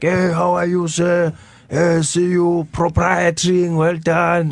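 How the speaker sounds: lightly voiced proud old man showing off his English; grandfather's greeting. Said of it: "He'd just run around and be like".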